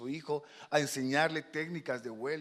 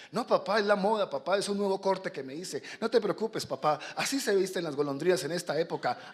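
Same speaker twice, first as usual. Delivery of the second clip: put-on higher, quick-excuse kid voice; glib young swallow; 5 semitones higher, 6 dB louder than usual. Young swallow excusing missing feathers to papa, glib. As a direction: brush papa's worry off airily. Son brushes off papa's worry.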